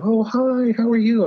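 high, flirtatious greeting; flirty lady. Retold or imitated. Imitating the flirtatious woman in the hostel, high and coy. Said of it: "she's kind of".

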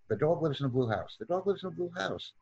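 fast reading drill; sped-up reading. Shown as a demonstration. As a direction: run the sentence together rapidly. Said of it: "increase our speed when reading".

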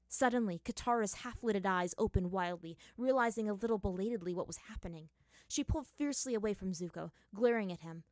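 ordinary delivery, nothing marked

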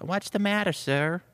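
high, concerned assistant; assistant's voice. Acted. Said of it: "so the assistant's like".

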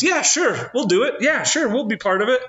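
high, hammed-up imitation of his wife; high-pitched wife impression. Husband mimics wife agreeing.

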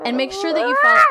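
big, high theatrical delivery; hammy stage actor. Acted. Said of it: "You're definitely, like, a theater".

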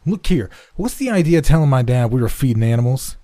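put-on loud, high-pitched boy's accusation; fierce. A narrator's reading. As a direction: round on your friend angrily. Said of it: "Red turned fiercely upon his companion".

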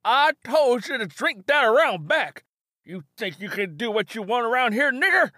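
put-on high, loud old man's voice, furious; angry old Ben. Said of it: "Ben, old man angry at Simi".

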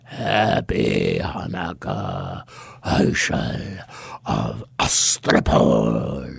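very low, very slow monstrous voice; bone-cracking goblin king. Acted. Goblin king greeting Herschel, deep and slow. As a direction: menace him from the dark. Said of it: "a voice that sounded like the cracking of bones".

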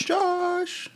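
very high, playful name call; high-pitched call. Pretend. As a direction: sing out the name in a tiny high voice. Co-host calls out the guest's name.

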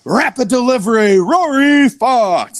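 booming announcer shout, Rory Fox drawn out slowly; ring announcer call. Retold or imitated. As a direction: hype the name like a ring announcer. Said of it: "It's like".

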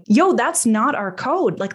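ordinary delivery

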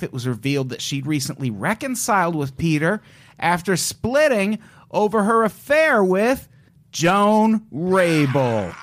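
normal talking voice

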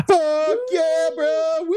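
high, loud hammed-up bro whoop; mock bro cheer. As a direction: cheer like a hyped teen. Imitating kids cheering.

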